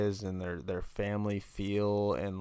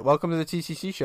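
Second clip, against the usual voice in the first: higher, louder mock host intro; eager host; 7 semitones higher, 4 dB louder, faster than usual. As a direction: launch the show like an eager host. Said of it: "He was like".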